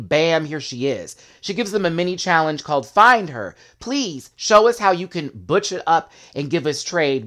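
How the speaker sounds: ordinary delivery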